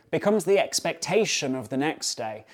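normal talking voice

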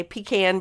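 PECAN as PEE-can, the way others say it; common mispronunciation. Shown as a demonstration. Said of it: "a lot of people will say".

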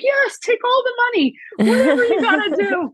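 high, breathless eagerness; eager yes. Retold or imitated. Owner to the vet, eagerly begging her to run tests.

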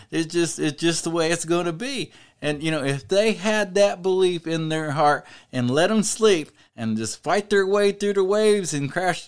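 rally the listeners with rolling conviction; fervent preaching. Real, not acted. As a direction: drive each repeated point home. Preaches insistently on faith.

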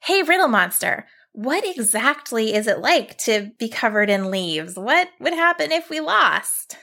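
put-on girl asking eagerly; young girl voice.

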